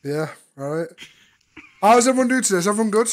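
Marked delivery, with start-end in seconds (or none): 1.8-3.1 excited greeting. Real, not acted. Greeting stream viewers.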